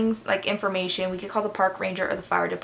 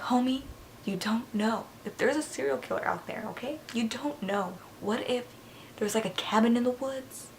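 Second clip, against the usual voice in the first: soft, higher mock-worried aside; hushed retort; 3 semitones higher, 8 dB quieter than usual. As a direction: argue back under your breath. Acted wary retort to helper.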